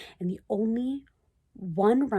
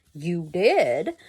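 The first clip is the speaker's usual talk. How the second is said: surprised; 3 semitones lower, 3 dB louder than usual. A narrator's reading. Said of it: "cried Doctor Moore".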